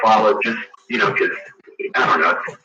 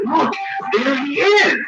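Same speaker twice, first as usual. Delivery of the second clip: put-on much higher, slow child's voice; little girl; slower than usual. Little girl showing the family her dead brother, high child voice. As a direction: point and say it like a wide-eyed small girl.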